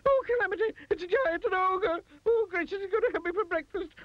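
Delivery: very high hysterical wailing; screaming panic. A narrator's reading. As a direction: dread being eaten by an ogre. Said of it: "he screamed".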